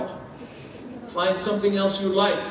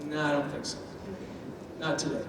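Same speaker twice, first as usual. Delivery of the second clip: soft, aloof brush-off; cool refusal; 5 semitones lower, 11 dB quieter, faster than usual. Pretend. Spicy child brushing off the step parent, quietly aloof. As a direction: turn them down quietly without interest. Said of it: "And they're like".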